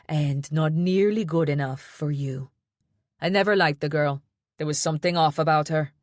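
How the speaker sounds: put-on disapproving, in her Jamaican accent. A narrator's reading. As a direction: grandmother scorning her son's ex-wife. Grandmother's accented voice continues.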